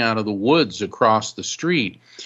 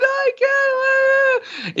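mock wail. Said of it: "this lady's screaming".

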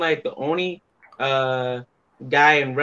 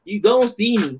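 raised, louder taunting warning; playful threat; 6 semitones higher, 3 dB louder than usual. Retold or imitated. Guest imitates himself warning his rival, mild taunt. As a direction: tease that he can't hide. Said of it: "he's just like".

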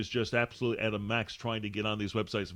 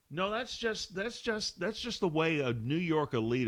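higher, snooty mock of an elite; 6 semitones higher than usual. Host parodying New York elites, snooty high voice. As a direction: sneer like a New York snob.

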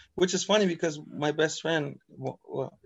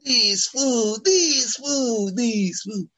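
high, chanted Chicano grumble; mock Mexican-American accent; 7 semitones higher, 4 dB louder, much slower than usual. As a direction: repeat it whiningly in a put-on Chicano accent. Imitating exasperated Chicano complaint.

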